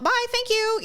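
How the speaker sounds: high, breezy goodbye; chirpy sign-off. Retold or imitated. Customer leaving the cashier quickly, chirpy. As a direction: re-enact a quick polite exit.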